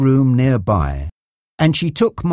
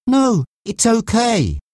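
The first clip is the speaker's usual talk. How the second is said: high, drawn-out insistence; slow, emphatic protest in a raised pitch; insistent; 11 semitones higher, slower than usual. A narrator's reading. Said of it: "Mark insisted".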